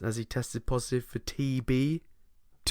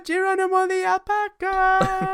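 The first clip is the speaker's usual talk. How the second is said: put-on Indian accent; 11 semitones higher, 5 dB louder than usual. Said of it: "You just went really Indian again".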